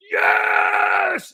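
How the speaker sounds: very high, loud, drawn-out yell; ticked-off scream. Acted. Walker provoked by the trainer, ticked-off scream. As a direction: let it rip in a long roar. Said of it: "I'm kinda ticked off".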